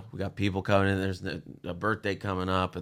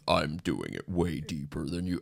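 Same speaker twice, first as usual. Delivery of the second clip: deep voice; 3 semitones lower, 4 dB quieter, slower than usual. Pretend. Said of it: "listeners at home".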